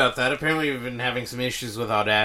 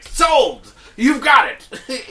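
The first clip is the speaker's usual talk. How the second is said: much higher, loud, slow, laughing; eager executive; 11 semitones higher, 11 dB louder, much slower than usual. Retold or imitated. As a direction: bellow it like a thrilled TV boss. Netflix exec voiced in story.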